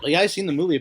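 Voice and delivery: ordinary talk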